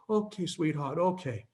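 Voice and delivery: gentle, lightly voiced grandma agreeing; soothed grandmother. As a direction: imitate a contented old woman drifting off. Soothed grandmother agrees, going to sleep.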